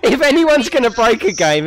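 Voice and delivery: loud, high, laughing admission. Real, not acted. Player owning up to breaking the game, laughing loudly. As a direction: say it straight out of a big laugh.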